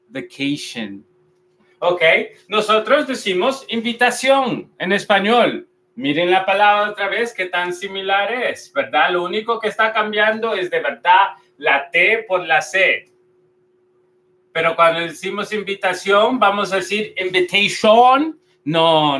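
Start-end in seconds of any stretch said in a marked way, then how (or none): 0.1-1.1 "vacation" as "ve-KEI-shen", softer and lower; correct English pronunciation. Shown as a demonstration. Teacher repeats the model for learners, correctly pronounced. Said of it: "vamos a decir vacation". 17.2-18.4 "invitation" as "im-bi-tei-SHON", stretched full O; wrong Spanish-style pronunciation. Shown as a demonstration. Teacher tests learners with the mistake, mispronounced. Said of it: "no vamos a decir invitation".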